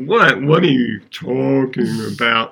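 lightly imitated dazed movie character; a hint of a tranquilized man's bewilderment; Will Ferrell. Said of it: "And Will Ferrell's like".

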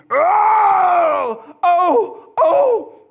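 loud, high, anguished wailing oh; tormented cries. Retold or imitated. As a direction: cry out the man's possessed howls, loud and high. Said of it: "the sounds he was making".

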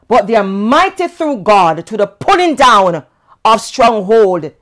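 loud, high full-force preaching. Acted. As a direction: preach it out with fire.

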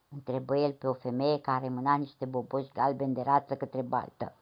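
usual speaking voice

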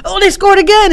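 high-pitched excited game-watching cry; excited fan. Acted. Fan reacting to another touchdown, excited. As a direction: react like a fan seeing a score. Acting out fans watching scoring.

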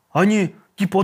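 usual speaking voice

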